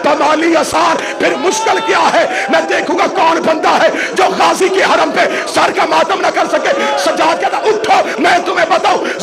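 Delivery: impassioned majlis sermon, half-shouted and rising; fervent lament sermon, rising toward a cry; preaching at full force. Acted. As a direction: preach it out to the mourners, pushing each line harder.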